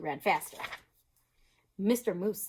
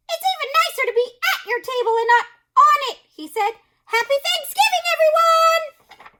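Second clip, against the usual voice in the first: very high, louder joyful voice; Turkey; 13 dB louder than usual. Acted. Turkey cheerfully toasts his new friends. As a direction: burst out in relief.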